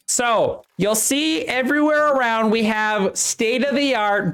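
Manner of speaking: a chipper supervisor showing new hires around; cheerful elf foreman. Acted.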